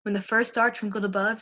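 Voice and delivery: plain reading voice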